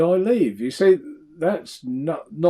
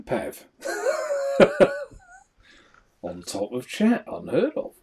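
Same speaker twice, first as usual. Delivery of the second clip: ordinary talk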